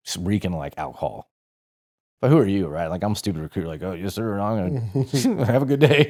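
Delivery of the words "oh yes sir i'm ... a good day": nervous mock politeness. Recruit humoring a drunk instructor.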